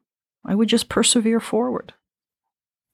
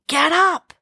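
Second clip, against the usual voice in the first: much higher urging call; a high, insistent inner voice; urgent voice; 11 semitones higher, much slower than usual. Retold or imitated. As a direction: lift the voice high to rouse her. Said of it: "that same voice saying".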